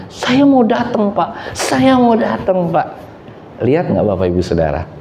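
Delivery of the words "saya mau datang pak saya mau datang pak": slow, high, insistent eagerness; acted heartfelt eagerness; eager promise. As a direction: imitate someone earnestly promising, stretched out. Retold driver eagerly promises to come.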